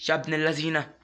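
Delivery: ordinary delivery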